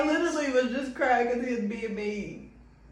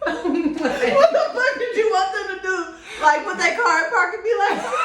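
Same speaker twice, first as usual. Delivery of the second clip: laughing hard, much higher; 14 semitones higher, 7 dB louder than usual. Real, not acted. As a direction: disbelief breaking into laughter. Laughing at friend's car story.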